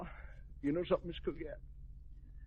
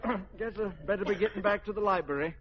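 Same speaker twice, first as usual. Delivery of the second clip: usual speaking voice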